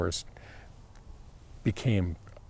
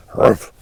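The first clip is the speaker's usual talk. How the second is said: a loud dismissive humph through the nose; scoffing humph; 9 dB louder than usual. Retold or imitated. Host imitating skeptical viewers, loud mock scoff.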